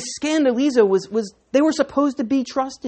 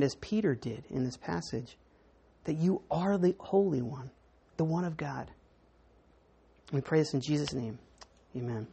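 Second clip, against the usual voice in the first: soft, earnest opening prayer; 7 semitones lower, 5 dB quieter than usual. Acted. Pastor praying for the congregation, hushed and sincere. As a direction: pray quietly and sincerely to God. Pastor prays before the sermon.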